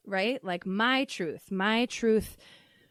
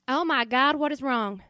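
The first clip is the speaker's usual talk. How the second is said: put-on higher, louder alarmed mom with a Southern accent; 4 semitones higher, 5 dB louder than usual. Mom answers her child's call at work, alarmed Southern accent. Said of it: "this weird accent that I'm choosing".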